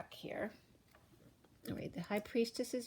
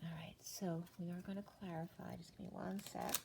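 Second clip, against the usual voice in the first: soft whispered aside; whispering; 8 dB quieter than usual. Real, not acted. Aside before clarifying cards.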